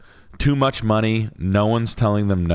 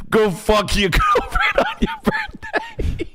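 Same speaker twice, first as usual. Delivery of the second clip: laughing shout; slower than usual. Pretend.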